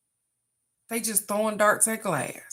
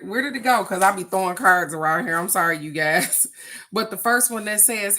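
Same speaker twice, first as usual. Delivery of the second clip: normal talking voice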